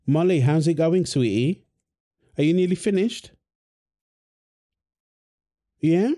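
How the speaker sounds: usual speaking voice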